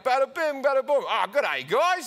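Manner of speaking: high, loud hammed-up gangster with a g'day twang; Australian guy in Goodfellas, high and loud; mock mobster Aussie. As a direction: barge in like an Aussie extra in a mob film. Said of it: "an Australian character in Goodfellas".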